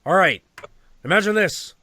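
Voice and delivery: high, loud start to a tale; storyteller launching a tale. Retold or imitated. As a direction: grab the room, then begin. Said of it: "And he's like".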